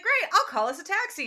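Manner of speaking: quick, high, chipper announcement; brisk take-charge. Retold or imitated. Annabeth taking charge for the group, mock chipper and brisk.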